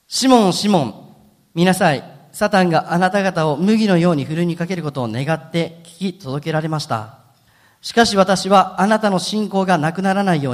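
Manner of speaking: measured, solemn reading of the Bible passage; scripture reading. Acted. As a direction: read the Gospel text steadily to the congregation. Reads scripture aloud.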